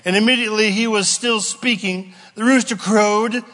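usual speaking voice

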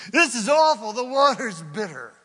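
high, loud disgusted complaint; disgusted outcry. Acted. Israelites tasting bitter water, loud disgusted cry. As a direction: spit it out in disgust.

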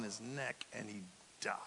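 whispered and he died; hushed telling. Acted. Preacher whispering Eli's death to the congregation. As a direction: let it fall to a whisper.

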